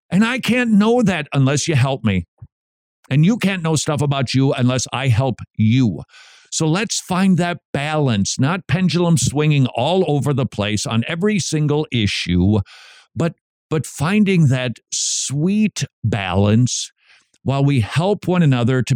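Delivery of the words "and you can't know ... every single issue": ordinary talk, nothing marked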